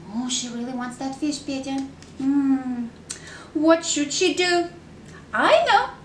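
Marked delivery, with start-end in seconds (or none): 5.3-5.9 much higher excited kitty; kitty cries out. A narrator's reading. Kitty finds the answer, a much higher excited cry. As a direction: pop up with the idea.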